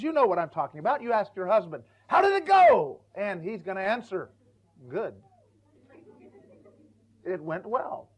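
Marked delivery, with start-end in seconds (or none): 2.1-3.0 higher and louder, an eager wife; a wife eagerly asking her husband, higher and louder; imitated wife. 4.9-8.1 softer, terse husband's answer; a husband's curt reply to his wife, softer. Retold or imitated.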